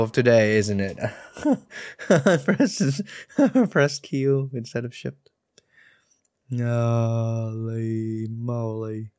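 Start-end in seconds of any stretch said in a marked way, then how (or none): 1.4-5.3 high, breaking into laughter; laughing. Real, not acted. Player admitting the wrong key press, laughing. 6.5-9.1 very slow, long-held vowels; drawn-out dismay. Real, not acted. Player taking in the mistake, slow drawn-out dismay. Deflated after own fail.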